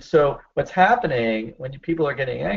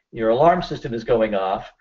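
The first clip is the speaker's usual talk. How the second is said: ordinary talk, nothing marked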